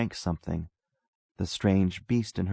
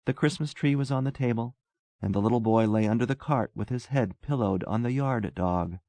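usual speaking voice

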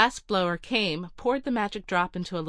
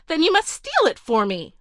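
high, louder petulant demand; retort; 9 semitones higher, 6 dB louder than usual. Acted. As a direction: snap back like a spoiled heiress. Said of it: "she retorted".

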